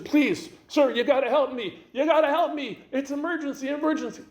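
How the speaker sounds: high, loud panicked pleading; acted emergency, pitch way up; excited, nervous. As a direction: beg for help in a hammed-up frenzy. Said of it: "most excited voice, most nervous voice".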